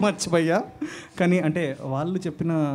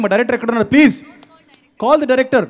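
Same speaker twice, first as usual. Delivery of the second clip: loud, higher call across the hall with a laugh; 6 semitones higher, 11 dB louder than usual. Real, not acted. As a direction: shout for the director, laughing. Calling for the director on stage.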